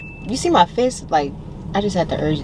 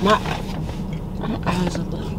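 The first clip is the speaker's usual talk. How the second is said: whispering; 4 dB quieter than usual. Real, not acted. Mic trouble aside.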